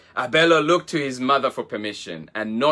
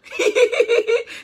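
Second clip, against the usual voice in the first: put-on high girlish giggle; squeaky baby hippo giggle; 4 dB louder than usual. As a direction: let out a bright little giggle.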